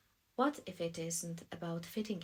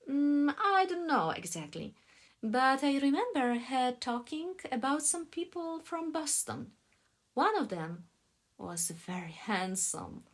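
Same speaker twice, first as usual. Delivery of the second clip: high, loud female part; woman character's voice; 7 semitones higher, 7 dB louder than usual. A narrator's reading. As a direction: open on a hummed Mm, pitched up. Woman answers in read dialogue.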